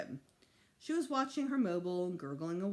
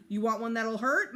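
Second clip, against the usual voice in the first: stern threat; 4 semitones higher, 8 dB louder than usual. A narrator's reading. Mother threatening a spanking.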